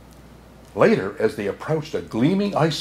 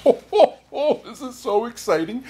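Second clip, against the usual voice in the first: Santa chuckle; 11 semitones higher than usual. Acted. Santa chuckling at his own name in the story, high. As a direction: laugh with glee.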